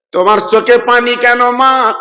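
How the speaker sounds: ordinary talk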